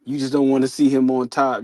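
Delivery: usual speaking voice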